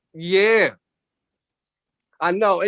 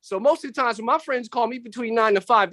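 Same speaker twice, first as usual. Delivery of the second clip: normal talking voice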